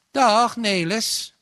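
hoge, luide en gerekte groet; luide begroeting. A narrator's reading. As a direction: begroet de jongen hoog, luid en langgerekt. Begroeting van Nelis bij binnenkomst. (hartelijk luid gegroet)

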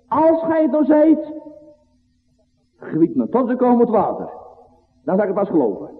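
high, pleading doubter; voicing Peter. Preacher voicing Peter asking Jesus for a sign, high pleading. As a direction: ask with a hint of Peter's doubt.

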